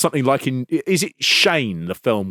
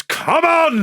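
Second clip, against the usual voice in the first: mock taunt. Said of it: "And Rude goes".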